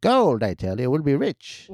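lightly voiced old prospector; a hint of a greedy old man. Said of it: "love doing old man voice".

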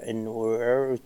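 "water" said very slowly, with the R dragged out and overdone; a mocking imitation that lingers on the R in "water"; mock rolled R. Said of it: "تبلش لي بحرف ال R، تقعد تعلق عليه".

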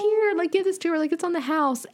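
high friendly clerk voice; front desk voice. Retold or imitated. Vlogger imitating the hotel clerk sending free ice cream, high and friendly. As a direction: play the clerk, kindly handing over a treat.

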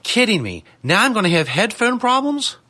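high-pitched, incredulous rant; exasperated meltdown. Real, not acted. Radio host losing it over failing headphones, exasperated. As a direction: fume in rising, disbelieving questions. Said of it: "hear me have a fucking meltdown".

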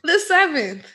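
thrilled at sharing a birthday month; excited shout. Real, not acted. Learns they share July birthdays.

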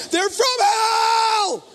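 high, loud and very slow; mock horror shout. Preacher tells the congregation about seatmates, slow loud shout. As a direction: drag out the outrage.

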